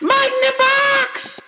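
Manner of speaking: very high squeaky mascot voice; cereal mascot. Acted. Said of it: "mascot line".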